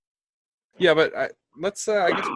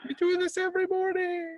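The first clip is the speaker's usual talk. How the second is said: spooky ghost. Acted. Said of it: "we're ghostly".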